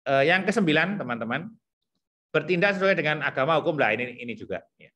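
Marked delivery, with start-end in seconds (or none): none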